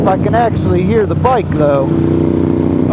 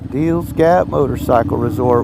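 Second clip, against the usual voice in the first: low, softer, to himself; lower quieter repeat; 6 semitones lower than usual. Shown as a demonstration. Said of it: "World famous".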